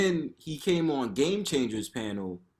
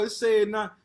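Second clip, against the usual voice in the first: usual speaking voice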